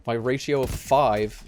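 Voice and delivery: pumped-up gamer talk; excited. Real, not acted. Excited after kills.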